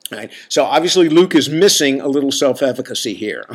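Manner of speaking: ordinary talk, nothing marked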